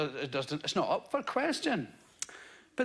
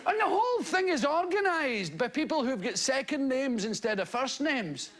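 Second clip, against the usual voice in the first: ordinary talk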